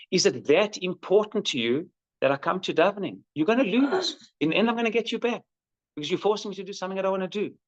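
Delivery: hammed-up son warning his dad, rising and rushing; defiant teenage son.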